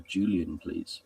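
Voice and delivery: normal talking voice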